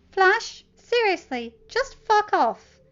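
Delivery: high, loud, slow fed-up snap; angry retort. Acted. Teen telling the bully to leave, angry. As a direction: tell him off, done with it.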